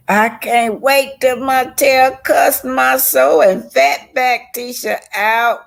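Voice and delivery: high, loud, slowly savoured glee; gleeful excitement. Real, not acted. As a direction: relish every word of it. Gleeful anticipation.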